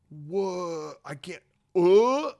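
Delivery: slow, rising, stammered disbelief; mock disbelief. The Republic reacts to shocking findings, mock stammering disbelief.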